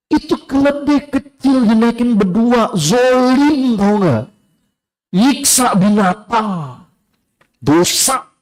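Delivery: high, slow, scandalised busybody; scolding netizen. Acted. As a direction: drag it out like an outraged gossip. (suara netizen nyinyir tinggi dan lambat)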